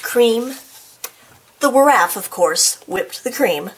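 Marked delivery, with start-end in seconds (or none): none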